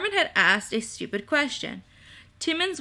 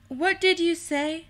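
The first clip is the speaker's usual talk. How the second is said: raised pitch, questioning lift at the end; sharp query; 7 semitones higher than usual. A narrator's reading. Timmins catches Carmen's remark, high startled query. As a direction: ask it high, at a normal speed.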